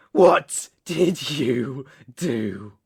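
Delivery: loud, slow, every word hammered; angry demand. Acted. Captor repeats his unanswered question, angry demand.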